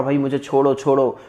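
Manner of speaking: ordinary talk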